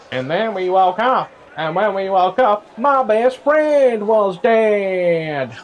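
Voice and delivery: high, slow storyteller voice of Amber; Amber impression. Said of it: "Is that your Amber impression?".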